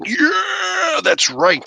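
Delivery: sudden high cry of victory; triumphant shout. Real, not acted. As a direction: shout it out when it finally pops. Triumph after popping part.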